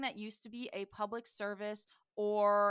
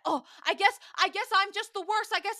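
loud, high-pitched guilt trip; mocking a wounded sulk at full volume; mock self-pitying outburst; 10 semitones higher, 10 dB louder than usual. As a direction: wail it like an over-dramatic martyr. Acting out a sulking man's outburst.

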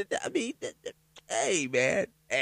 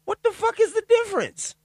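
outraged disbelief; 5 dB louder than usual. Real, not acted. Outraged at lenient sentence.

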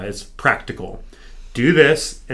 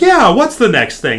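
louder, higher and slower, pumped up; fired-up echo; 8 semitones higher, 8 dB louder than usual. Retold or imitated. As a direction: throw the question back full of fire. Fired up, echoing trainer's challenge.

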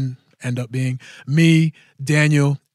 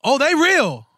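emphatic; 11 semitones higher, slower than usual. Real, not acted. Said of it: "answering the doubters".

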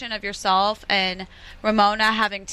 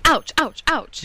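pretend pain; 5 semitones higher, slower than usual. Miming burnt fingers at toaster oven.

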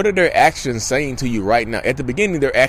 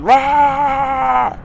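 mock roar; 11 semitones higher than usual. Imitating a rage apology.

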